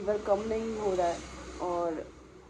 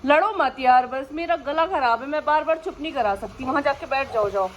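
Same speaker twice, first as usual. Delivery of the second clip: higher, louder exasperated scolding; scolding dogs; 6 semitones higher, 8 dB louder than usual. Real, not acted. To the two dogs fighting, loud exasperated scolding. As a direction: tell them off, tired and fed up.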